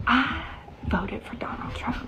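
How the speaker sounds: hushed, secretive whisper; whispering it to a neighbour at the curb; whispered confession. Retold or imitated. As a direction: lean in and breathe it like a secret. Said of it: "you don't need to say it out loud".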